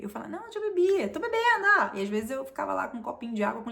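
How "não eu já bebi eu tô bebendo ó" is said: high, insistent fake reassurance; mock insistence. Telling the party she is drinking, high and insistent. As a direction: sell the lie that you are drinking.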